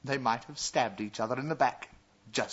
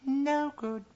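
high dismissive princess; cartoon princess. A narrator's reading. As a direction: wave him off, bored and high.

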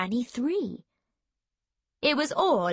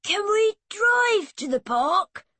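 put-on high, slow little-boy voice; young boy's plea; 10 semitones higher, 4 dB louder, slower than usual. A narrator's reading. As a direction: ask hopefully as a small boy. Boy pleading in a story.

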